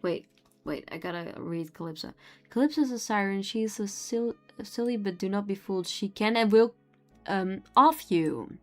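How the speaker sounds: ordinary talk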